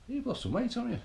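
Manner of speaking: family member noticing you've slimmed, mock-astonished; surprised relative. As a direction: imitate someone amazed at your weight loss. Family noticing weight loss.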